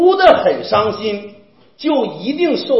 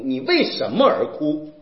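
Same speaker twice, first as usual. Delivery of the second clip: ordinary talk, nothing marked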